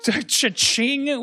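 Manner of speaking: high cha-ching, a touch of a cry; jeering cash register noise; mock cash register cha-ching. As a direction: sing out the cash register sound. Mocking profit noise.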